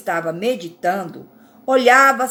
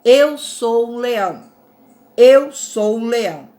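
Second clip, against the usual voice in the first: lion's mantra; 5 dB louder than usual. Shown as a demonstration.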